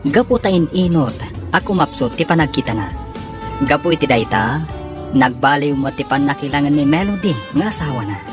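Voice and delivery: ordinary talk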